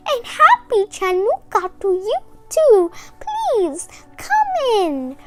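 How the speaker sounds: put-on old grandmother voice. A narrator's reading. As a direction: speak up like a kind old lady at the door. Grandmother welcoming guest.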